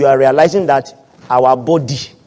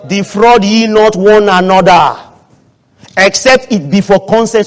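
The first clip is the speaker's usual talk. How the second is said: loud, measured scripture proclamation; emphatic preaching; 4 semitones higher, 7 dB louder than usual. Real, not acted. Preacher teaching the church not to defraud, emphatic preaching. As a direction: drive the verse home to the church.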